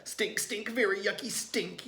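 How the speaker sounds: high mock yell. A narrator's reading. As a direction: yell about the stink. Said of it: "yelled Tate".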